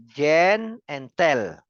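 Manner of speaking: GEN and TEL drawn out as two separate beats; syllable by syllable. Shown as a demonstration. Teacher showing students the two syllables, slowly syllable by syllable. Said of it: "when we say two syllables".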